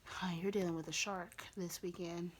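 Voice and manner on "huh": ordinary talk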